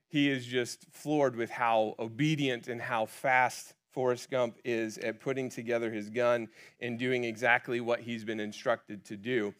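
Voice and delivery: ordinary delivery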